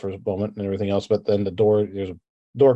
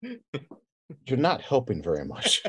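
quiet chiding; 2 semitones higher, 6 dB quieter, much slower than usual. Acted. Julius chides the party.

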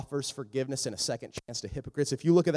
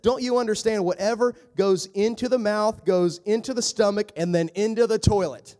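higher, louder, blunt exasperated Jesus; 6 semitones higher, 6 dB louder than usual. Retold or imitated. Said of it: "here's what it sounded like to them".

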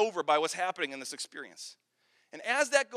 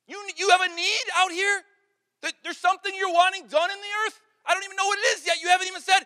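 eager volunteer; 9 semitones higher, 7 dB louder than usual. Acted. Isaiah pleading eagerly.

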